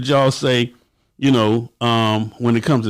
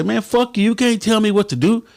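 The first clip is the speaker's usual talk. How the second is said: higher, mocking hot-headed retort; defiant member; 10 semitones higher than usual. Member defies his president: high mocking defiance. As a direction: sass back like a hothead. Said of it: "Or will you say".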